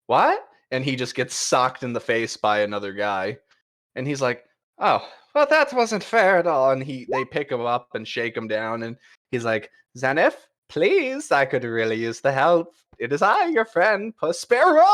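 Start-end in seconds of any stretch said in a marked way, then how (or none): 0.1-0.5 sudden high, loud what; startled yelp. Acted. Rogue cat startled just before being punched, high yelp. 4.8-6.7 high, theatrical rogue greeting; swashbuckling cat rogue. Acted. GM voicing a rogue greeting Zenith, high and theatrical. After being socked. 10.0-14.9 high, theatrical rogue greeting; swashbuckling cat rogue. Acted. GM voicing a rogue greeting Zenith, high and theatrical. Begging for help.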